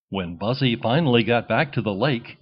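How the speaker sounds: ordinary delivery, nothing marked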